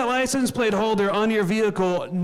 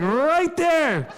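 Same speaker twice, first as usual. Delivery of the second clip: long drawn-out, high swoop; slow, high, exaggerated drawl; demonstrative exclamation; 8 semitones higher than usual. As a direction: stretch it out slowly and swoop up high. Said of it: "if I could just go, like".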